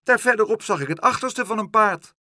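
high, loud, insistent shout. A narrator's reading. Boy contradicting a horse across water, shouting. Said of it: "schreeuwde Pluk".

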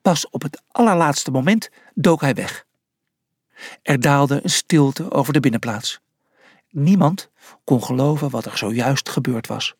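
usual speaking voice

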